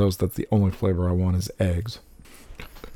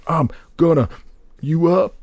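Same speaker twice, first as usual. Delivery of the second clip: shouted rap line, higher; 7 semitones higher than usual. Retold or imitated. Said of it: "D M X is like".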